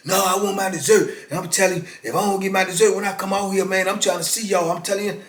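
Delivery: lightly voiced brash youngster demanding dessert; mocking a young hothead. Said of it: "young inmate threatening over dessert".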